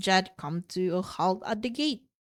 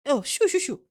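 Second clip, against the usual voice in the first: dismissive shooing; 5 semitones higher than usual. Acted. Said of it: "waved off my statement".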